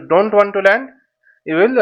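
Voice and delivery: normal talking voice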